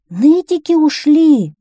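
high, loud, drawn-out announcement; shouting. A narrator's reading. Townspeople telling the skinny lady the news, shouting. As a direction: cry out the news together. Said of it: "закричали все".

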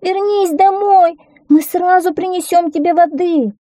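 put-on high-pitched pleading child voice; high, insistent children's plea; squealing children. A narrator's reading. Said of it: "запищали все четверо".

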